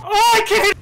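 high, loud real outburst; frustrated yell. Real, not acted. Gamer missing his shots, frustrated yell.

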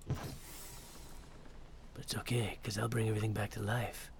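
a lightly gruff, Geralt-ish hero voice, a hint of it only; Pathfinder voice. Player voicing his Pathfinder like Geralt, understated hero voice. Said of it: "I guess this is my Pathfinder voice".

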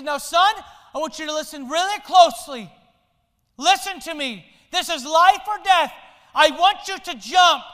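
dad calling up to his son to jump; urgent dad. Acted. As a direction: urge him to trust and jump.

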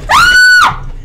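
high jump-scare scream. Real, not acted. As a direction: shriek at a sudden fright.

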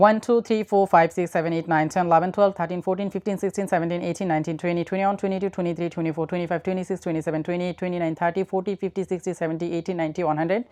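numbers rattled off in one quick run without pauses; fast counting. Shown as a demonstration. Teacher showing students the fast drill, quick unbroken counting. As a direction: rush through one to a hundred as a drill. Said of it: "খুব দ্রুত বলার চেষ্টা করব".

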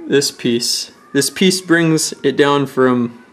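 usual speaking voice

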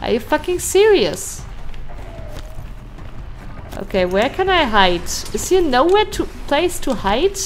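high frantic panic. Real, not acted. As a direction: scramble for cover, panicking. Frantic search for cover.

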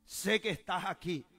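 high, loud insistence in prayer; loud declaration. Retold or imitated. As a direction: cry it out to heaven.

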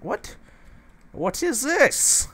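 slow, very high "What is this?"; high surprised squeak. Real, not acted. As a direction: squeak it out in disbelief. Surprised by a cow in the forge.

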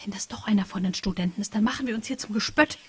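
hushed worried whisper. Acted. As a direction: keep it under your breath so no one hears. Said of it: "flüsterte Patricia". (besorgtes Flüstern)

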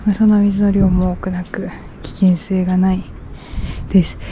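shy whisper so passersby won't hear. Real, not acted. Vlogger embarrassed by passersby on the street, whispering. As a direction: whisper it, embarrassed by the people walking past. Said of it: "小声の小声だし".

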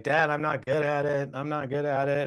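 mild imitation of a tearful kid; retold tearful protest, held back; crying child. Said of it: "he's crying".

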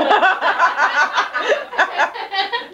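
high, bright laugh breaking out; burst of laughter. Real, not acted. Reader laughs at own story.

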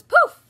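loud high poof; magic poof. Acted. Mother reading the fairy's spell, loud high poof.

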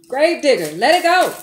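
loud, rising call of Gravedigger, let it go. Real, not acted. Tarot reader calling out to the querent, loud insistent call. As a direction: call out across the room, insistent.

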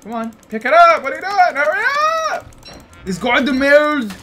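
loud, high, hurried; impatient yelling. Real, not acted. Yelling at slow in-game worker.

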